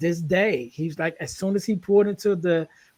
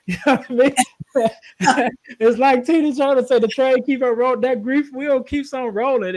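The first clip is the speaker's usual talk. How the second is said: higher, louder, laughing through it; 6 semitones higher, 3 dB louder than usual. Real, not acted. Laughing while quoting [name].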